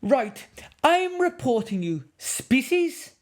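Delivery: high, loud, indignant man scolding; furious filmmaker. Acted. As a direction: tell off the naughty cub in outrage. Filmmaker threatens cub.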